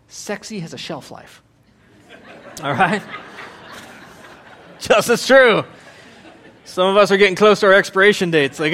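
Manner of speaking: ordinary talk, nothing marked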